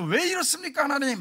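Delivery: very high mock wail of protest; aggrieved outcry. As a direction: cry out "why" to God, hammed-up. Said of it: "막 이렇게 해야 되잖아요".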